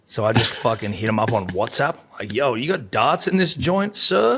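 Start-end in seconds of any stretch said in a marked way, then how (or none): 2.3-4.4 bro-ish ask, pitched up and louder on sir; cocky mock request. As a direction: swagger it like a mate asking the butler. Mock texting the hotel butler.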